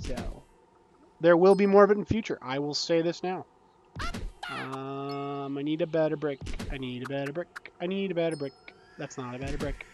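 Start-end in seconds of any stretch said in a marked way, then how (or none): 5.6-9.9 very soft, absorbed murmur; muttering to oneself. Real, not acted. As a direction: mutter under your breath while concentrating. Concentrating on placing bricks.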